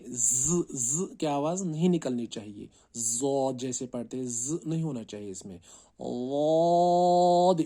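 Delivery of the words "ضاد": واد chanted long and thick, high and loud; teacher modelling a thick ضاد; heavy stretched letter. Shown as a demonstration. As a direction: recite ضاد heavy, no ز sound. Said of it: "موٹا پڑھنا ہے اور کھینچ کے".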